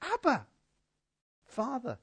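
high, soft involuntary cry; unguarded cry. Acted. Preacher showing Paul's cry just coming out, soft and unguarded. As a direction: let it slip out high and hushed, unguarded. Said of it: "It just comes out".

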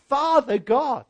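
high-pitched, heartfelt cry. Acted. Preacher voicing a child of God's worship, crying out high. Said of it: "you just cry out".

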